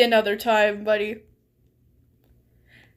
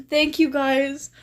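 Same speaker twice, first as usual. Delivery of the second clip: ordinary talk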